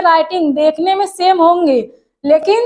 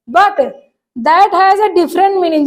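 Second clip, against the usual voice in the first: slow dictation reading; much slower than usual. Shown as a demonstration. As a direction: read the definition very slowly, word by word. Reads definition slowly. (धीमे-धीमे परिभाषा पढ़कर सुनाना)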